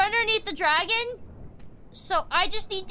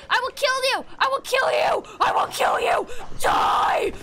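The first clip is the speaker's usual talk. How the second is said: yelled kill threats, ending in a raspy shout; battle cry; 3 semitones higher than usual. Real, not acted. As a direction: roar it at the enemy. Attacking enemies, battle cry.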